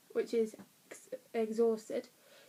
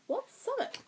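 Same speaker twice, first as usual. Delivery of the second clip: high, squeaky-confused question; puzzled reader; 12 semitones higher, 4 dB quieter than usual. Acted. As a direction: ask it as a baffled reader, pitched way up.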